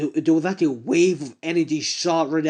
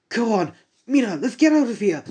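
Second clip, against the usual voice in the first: higher, pressing urge to flee; urgent call; 6 semitones higher than usual. Acted. Kirishima urges Mina to run, higher urgent call. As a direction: hurry her along.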